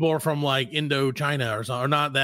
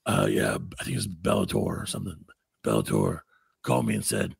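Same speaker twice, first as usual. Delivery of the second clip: Steven Seagal impression; 7 semitones lower than usual. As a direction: mumble low like Seagal. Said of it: "And he's like".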